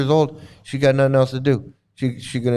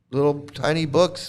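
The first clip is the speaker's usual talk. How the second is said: ordinary talk, nothing marked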